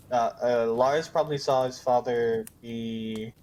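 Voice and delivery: normal talking voice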